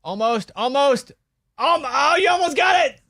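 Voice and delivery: high, loud cheering shout; excited shout. Real, not acted. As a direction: cheer them on, almost there. Cheering guest on during tongue trick.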